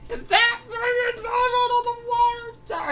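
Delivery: very high, loud squawk of disbelief; shocked yelling. Acted. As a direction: yell it in shock, voice shooting way up.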